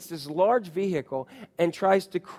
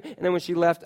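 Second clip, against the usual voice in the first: ordinary talk, nothing marked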